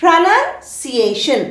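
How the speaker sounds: pronunciation drawn out syllable by syllable; very slow pronunciation for learners to copy, "nun" in the middle; repeat-after-me model. Shown as a demonstration. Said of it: "Repeat, please".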